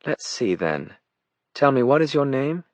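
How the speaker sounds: high, aristocratic British voice; British accent. A narrator's reading. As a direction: coolly question him in upper-class British. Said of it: "said Jenna in her British accent".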